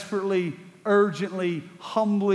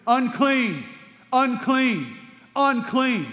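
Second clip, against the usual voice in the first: leper calling out; 6 semitones higher, 3 dB louder, much slower than usual. Acted. Leper crying out a warning.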